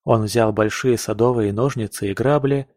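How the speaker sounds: ordinary delivery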